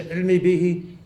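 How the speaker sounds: swallowed demonstration of the phrase; inward, swallowed. Shown as a demonstration. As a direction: say it into yourself. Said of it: "we are into ourselves".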